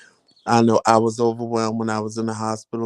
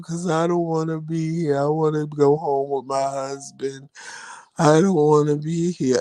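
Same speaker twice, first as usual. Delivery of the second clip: mock crybaby whine; 5 semitones higher than usual. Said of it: "just a crying and a crying".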